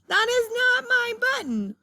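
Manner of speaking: a high, loud, stretched wail. A narrator's reading. Toad wailing at the raccoon's wrong button, high wail. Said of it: "wailed Toad".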